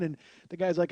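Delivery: usual speaking voice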